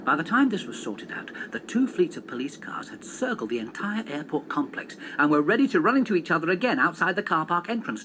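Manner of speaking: ordinary delivery, nothing marked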